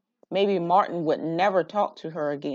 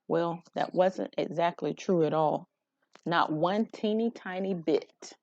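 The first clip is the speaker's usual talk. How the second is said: ordinary delivery, nothing marked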